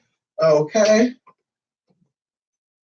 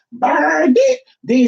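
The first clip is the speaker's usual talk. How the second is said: mock shout; 10 semitones higher, 4 dB louder, much slower than usual. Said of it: "they were like".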